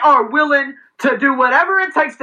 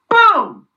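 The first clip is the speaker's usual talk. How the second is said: high, loud shouted boom; punchy cartoon explosion; mock explosion boom; 10 semitones higher, 4 dB louder than usual. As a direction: blurt it like a blast going off. Chain of infection spreading.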